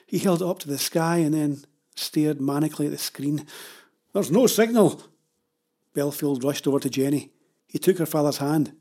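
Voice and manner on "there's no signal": high, loud, urgent report; alarmed shout. Acted. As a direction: call it out in alarm. Man panics over phone signal.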